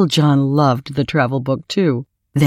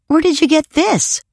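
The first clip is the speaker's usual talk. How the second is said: put-on much higher, wondering little-boy voice; amazed child; 13 semitones higher than usual. PJ to Uncle John on seeing the blanket, amazed. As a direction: marvel at the gift in a high voice.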